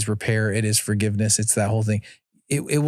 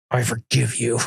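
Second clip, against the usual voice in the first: imitated grudging kid growl; growled forgiveness; 2 semitones lower than usual. Dad imitating his daughter forgiving him, growly and grudging. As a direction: growl the words out reluctantly. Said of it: "She growls and then she just goes".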